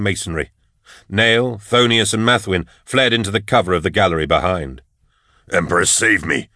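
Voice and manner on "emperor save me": Nail's gruff-soldier exclamation, understated; exclaiming. A narrator's reading. Nail sees the dreadnought coming, exclaiming. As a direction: exclaim it without shouting. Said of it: "Nail exclaimed".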